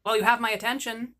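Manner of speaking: usual speaking voice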